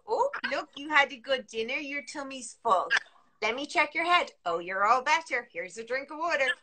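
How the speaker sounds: bright reassuring bedtime voice; soothing parent. Shown as a demonstration. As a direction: coax a child to bed gently. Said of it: "point at their tummy and go".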